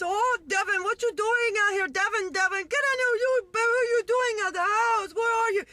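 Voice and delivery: very high, frantic mock Jamaican accent; crying Jamaican mother. Mother finds her toddler, hammed-up Jamaican accent. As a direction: wail in an imitated Jamaican accent. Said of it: "she starts crying, and she from Jamaica too".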